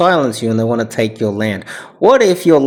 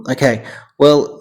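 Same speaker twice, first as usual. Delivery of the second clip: ordinary delivery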